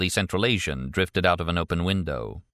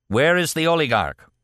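raised-voice interrogation; pressing demand; 8 semitones higher, 4 dB louder than usual. A narrator's reading. Man interrogating his wounded captive, demanding. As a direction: demand it, pitched up.

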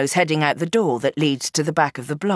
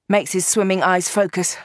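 plain reading voice

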